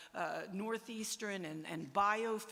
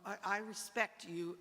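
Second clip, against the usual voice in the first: ordinary talk, nothing marked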